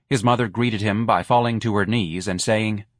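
usual speaking voice

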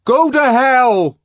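very loud, high, drawn-out yell; furious shout from behind a door; exasperated bawl. A narrator's reading. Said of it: "bawled the exasperated voice from within".

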